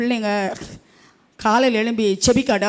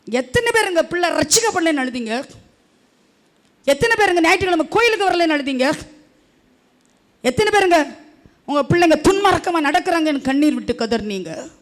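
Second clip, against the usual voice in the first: louder, higher rhetorical questions; preaching challenge; 7 semitones higher, 4 dB louder than usual. Acted. Pastor challenging parents who never wept for children, loud and high. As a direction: press the church with each question.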